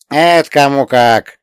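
grumbling coachman. Acted. Spirit grumbles back.

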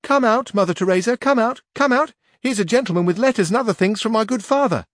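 high, loud call to her mother; girl calling her mother from the door, high and loud. A narrator's reading. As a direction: call out to the house. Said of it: "she called out at the door".

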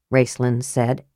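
ordinary delivery, nothing marked

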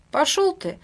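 high sneering insult; scornful brush-off. Acted. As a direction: spit it at him. Quarrel, dismissive insult.

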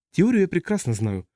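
dry reply. A narrator's reading. Customs officer cuts off an explanation of theory, drily. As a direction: answer drily, starting high. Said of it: "сухо ответил таможенник".

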